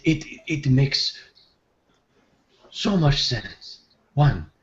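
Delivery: entranced, repeating words in wonder; dazed. Acted. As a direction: stammer slowly into a dawning revelation. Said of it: "almost in a daze, Malam would look".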